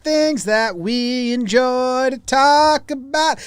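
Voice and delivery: slow, lightly sung-along announcer read; drawn-out show opener; radio host intro. Acted. Hosts the show intro slowly.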